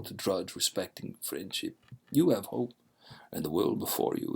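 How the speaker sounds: put-on grave stranger in a Dracula-style Eastern European accent; Dracula's accent. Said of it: "That's Dracula's accent".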